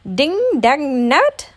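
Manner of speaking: high, loud, drawn-out exasperated curse; rueful snap. A narrator's reading. Gopher is recognised in his costume, snapping ruefully. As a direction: snap it in slow, frustrated bursts. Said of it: "snapped Gopher ruefully".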